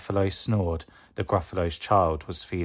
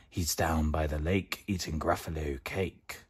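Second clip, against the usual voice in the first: 1 snake voice; 3 semitones lower, 6 dB quieter than usual. Acted. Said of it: "said the snake".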